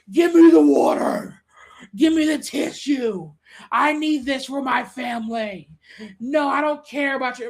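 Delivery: loud, slow, grabby demanding voice; greedy shoppers shouting. Retold or imitated. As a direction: bark orders like a selfish hoarder. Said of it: "But now everybody's like".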